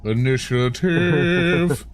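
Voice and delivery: mock announcement. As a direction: stretch the word out like a playful proclamation. Echoes the game master's call.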